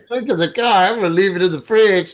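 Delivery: high put-on voice; mock friend voice. Teasing friend Reggie about the leftover cheese. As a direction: play the friend stuck with the cheese.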